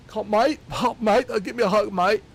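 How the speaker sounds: hammed-up excited British fan begging; British fan asking Keanu for a hug, high mock accent. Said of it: "some British guy".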